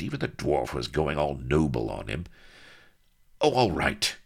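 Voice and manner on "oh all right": higher, exasperated giving in; irritated surrender; irritable. A narrator's reading. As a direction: concede grudgingly. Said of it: "he said irritably".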